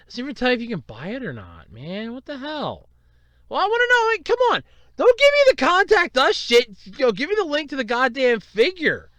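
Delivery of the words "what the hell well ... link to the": higher, loud frustrated complaint; annoyed rant at the screen; exasperated. Real, not acted. Complaining about the contact page, exasperated.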